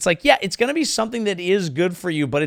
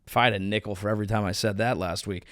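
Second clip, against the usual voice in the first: muttered aside; 6 semitones lower, 6 dB quieter, faster than usual. Real, not acted.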